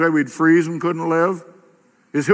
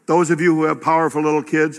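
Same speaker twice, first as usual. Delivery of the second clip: ordinary talk, nothing marked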